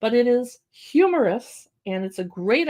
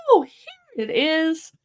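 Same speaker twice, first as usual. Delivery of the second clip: slow, higher delighted discovery; enthusiastic reveal; 7 semitones higher, 3 dB louder, much slower than usual. Shown as a demonstration. Showing the baby the hidden picture, slow delighted reveal. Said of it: "read it with an enthusiastic tone of voice".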